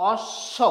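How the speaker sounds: "অশ্ব" split on a long hissed sh, b left silent. Shown as a demonstration. Teacher showing learners the silent ব, অশ্ব said as "osh-sho". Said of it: "তাহলে উচ্চারণ কেমন হবে?".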